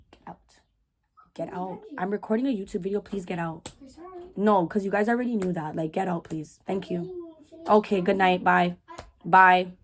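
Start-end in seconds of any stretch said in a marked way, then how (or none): 0.1-7.3 hushed shooing. Real, not acted. Shooing kids from room.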